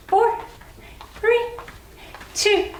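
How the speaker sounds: high, bright counting to finish; excited countdown. Real, not acted. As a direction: pump up the last counts. Countdown cheering the class.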